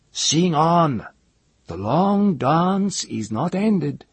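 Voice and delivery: high, slow chieftain command; commanding chief. A narrator's reading.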